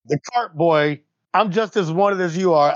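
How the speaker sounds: ordinary talk, nothing marked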